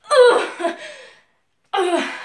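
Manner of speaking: loud strained struggling grunt; effortful grunt. Acted. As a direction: strain hard against the ropes. Straining against bonds.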